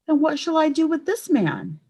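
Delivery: high, pleading outcry; crying out. A narrator's reading. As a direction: cry it out in dismay. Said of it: "he cried".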